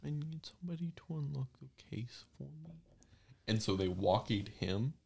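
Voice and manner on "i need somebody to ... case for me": whisper. Retold or imitated. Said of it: "and was like".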